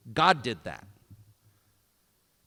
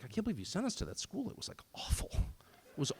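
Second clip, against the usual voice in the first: put-on hushed, breathy whisper of a grown kid; whispered quote; 10 dB quieter than usual. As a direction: whisper it like a confession. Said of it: "told me, like".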